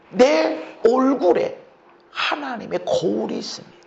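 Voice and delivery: ordinary delivery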